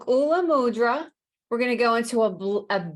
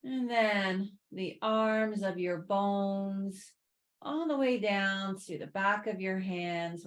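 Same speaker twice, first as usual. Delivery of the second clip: guided relaxation; 7 dB quieter than usual. Real, not acted. Yoga teacher guiding students to sink into the earth, soothing guided relaxation. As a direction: ease them gently down, unhurried and quieter. Guides a body scan lying down.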